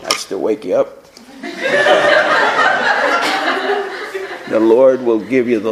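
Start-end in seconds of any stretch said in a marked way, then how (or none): 1.4-4.5 breathy burst of real laughter; a real high cackle; high unvoiced laugh. Real, not acted. Laughs at own remark.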